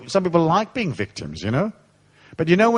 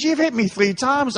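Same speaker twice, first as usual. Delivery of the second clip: higher, slow plaintive complaint; mock hurt protest; whining victim; 9 semitones higher than usual. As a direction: play the beaten bully's whine. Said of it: "He says".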